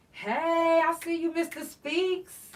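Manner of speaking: high, loud shout-out, slowed; loud playful greeting to a viewer; calling out. Real, not acted. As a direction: hail a friend from across the room. Calling out a friend.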